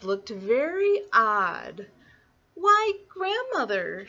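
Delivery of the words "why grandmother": high, slow wonder; puzzled little girl. Acted. Little Red puzzled by grandmother's looks, slow high wonder. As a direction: draw it out in childlike puzzlement.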